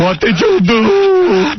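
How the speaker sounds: slow, high, drawn-out mock-dad wail; dad's scolding yell. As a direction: stretch out a father's angry demand, pitched high. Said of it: "I feel like my dad".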